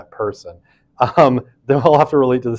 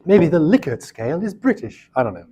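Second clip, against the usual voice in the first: put-on British accent, pitched up; a quick, bad stab at sounding British; terrible British accent; 5 semitones higher than usual. Shown as a demonstration. Lecturer mocking herself with a bad British accent. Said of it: "That's a terrible British accent".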